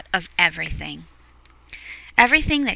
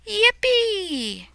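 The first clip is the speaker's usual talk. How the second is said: high squeaky cheer; cartoonish happy cheer; joyful yippee; 12 semitones higher than usual. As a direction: squeal with delight. Character cheer.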